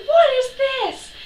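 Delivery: high, loud, slow demand; furious mum. Retold or imitated. Mum confronting George over the wall drawing, furious. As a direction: demand it like an outraged mother. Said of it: "my mum was like".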